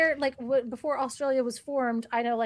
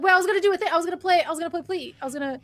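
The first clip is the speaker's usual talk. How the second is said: higher, louder mock-protest as the opponent; imitating Fumbles; 6 semitones higher, 4 dB louder, much faster than usual. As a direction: play the rival griping he got cut off. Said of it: "he's Fumbles is like".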